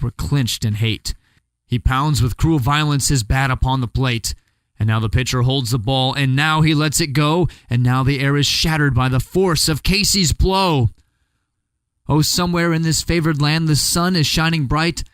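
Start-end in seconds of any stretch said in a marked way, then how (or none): none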